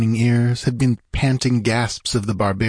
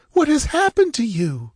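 sobbing. A narrator's reading. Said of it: "she sobbed".

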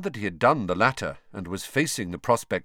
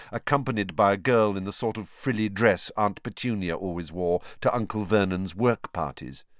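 ordinary delivery

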